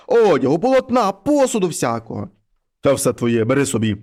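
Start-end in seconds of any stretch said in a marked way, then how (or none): 2.8-4.0 low, heavy voice of a wolf head at the door; low monster head voice. Acted. Wolf head knocks and calls the girl, low and heavy. Mare head gives reward.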